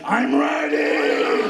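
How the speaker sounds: low, loud, drawn-out bellow; hammed-up low monster-like answer; mock readiness. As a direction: stretch it out in a deep roar. Acting eager self, put-on voice.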